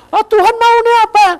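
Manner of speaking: high, loud mocking of a doubter; mock fretful voice, high and loud; doubting believer. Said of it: "Ndak usah kamu bilang".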